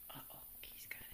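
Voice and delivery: suspenseful whisper. Real, not acted. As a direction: breathe it, no voice. Hushed suspense over ball.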